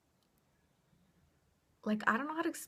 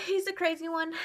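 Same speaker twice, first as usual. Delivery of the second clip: sighed, pitched way up and louder; exasperated sigh; 10 semitones higher, 5 dB louder than usual. Real, not acted. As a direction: groan it out, fed up with him. Sighing aside about the ex.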